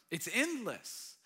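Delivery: normal talking voice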